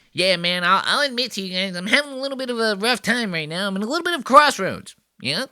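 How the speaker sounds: high, fast mocking voice of the guest; mocking small voice. Host mimicking the guest's podcast intro, high squeaky mockery. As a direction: squeak it out fast to mock his voice. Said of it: "his voice is very midgety".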